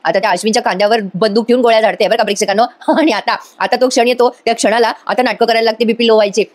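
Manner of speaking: ordinary talk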